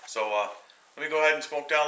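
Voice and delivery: talking around a cigar; cigar in mouth. Real, not acted. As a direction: lips closed on cigar. Seen 0: Cigar held at lips while talking; lowered 1.1-1.5, back 1.8.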